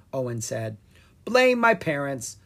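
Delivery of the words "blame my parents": put-on crusty old wizard. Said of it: "the crusty old wizard replied".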